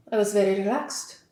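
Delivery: quietly imitating Fred with a faint French accent; the French boss, lightly mimicked with a trace of a French accent; slight French accent. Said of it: "That was not a French accent".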